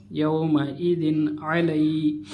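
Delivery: normal talking voice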